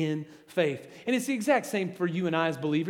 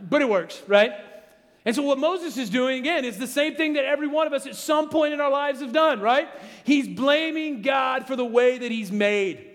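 higher, louder preaching voice; 4 semitones higher, 4 dB louder than usual. Acted. Pastor urging church not to make excuses, fervent preaching. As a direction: drive the point home to the congregation. Preaching, pitch and volume raised.

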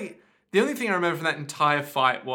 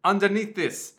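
higher mock Austrian, building to loud amazement; Austrian accent; 5 semitones higher, 4 dB louder than usual. Austrian host showing off something crushed, gleeful accent. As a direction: marvel like a delighted Austrian presenter. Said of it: "I sound like this with this accent".

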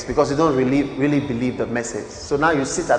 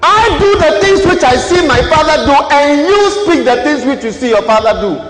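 louder, higher preaching repeat; full-force preaching; 11 semitones higher, 9 dB louder than usual. Acted. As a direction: hammer the verse home.

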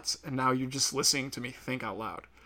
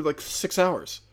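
ordinary talk

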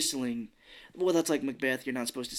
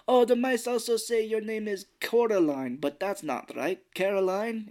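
put-on higher, singsong Russian accent; 7 semitones higher than usual. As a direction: puzzle it out in a mock Russian accent. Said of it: "I like your Russian voice".

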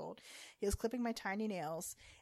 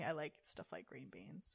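slow, hushed whispered aside; 6 semitones lower, 12 dB quieter than usual. Real, not acted. Admitting to her co-host she likes green beans, whispered. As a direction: let it slip out as a secret in a whisper.

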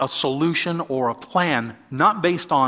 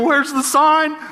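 high, loud frantic question; panicked driver searching for the sign, pitched way up; stressed; 12 semitones higher, 6 dB louder, slower than usual. Retold or imitated. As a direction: yelp it out hunting for the sign. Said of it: "I'm stressed".

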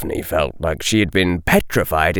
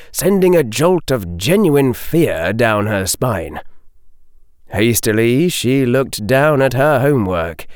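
usual speaking voice

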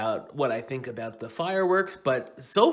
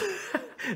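high giggle; 8 dB quieter than usual. Real, not acted. Laugh after Crypt Keeper bit.

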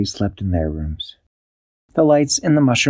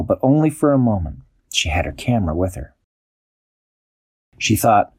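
usual speaking voice

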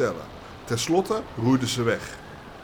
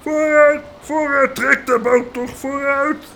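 much higher and louder, slow; boatsman shouting; 8 dB louder, slower than usual. Acted. Boatsman urging helpers to pull the boat, loud shout. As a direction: drive the men forward with a yell. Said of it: "de bootsman die riep".

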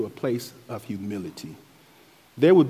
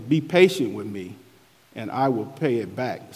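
usual speaking voice

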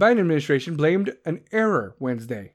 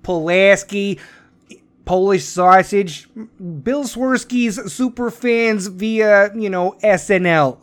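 a light South Side Chicago accent, louder; 3 dB louder than usual. Shown as a demonstration. Podcaster warming up with his Chicago accent, light Chicago accent. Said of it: "get my Chicago accent".

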